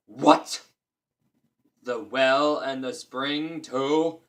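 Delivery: loud, slow giant roar; slow loud giant outrage; giant growl. A narrator's reading. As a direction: roar it in disbelief. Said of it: "growled the giant".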